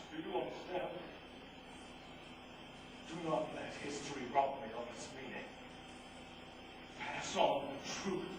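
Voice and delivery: low, soft fading voice; low, weakening, soft begging; dying plea. Acted. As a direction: plead with the last breath. Dying plea, after being shot.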